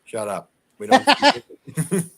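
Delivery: ordinary delivery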